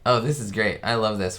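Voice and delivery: ordinary delivery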